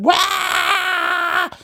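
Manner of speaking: very high, long mock scream; hammed-up mother's screaming cry; imitated screaming wail. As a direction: wail like a howling dog. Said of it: "Screaming like a dog".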